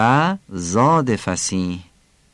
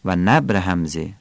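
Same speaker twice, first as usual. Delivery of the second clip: usual speaking voice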